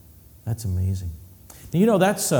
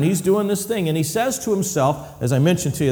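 normal talking voice

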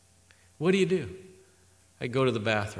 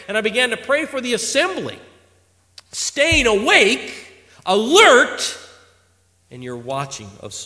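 ordinary talk, nothing marked

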